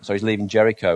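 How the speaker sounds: ordinary talk, nothing marked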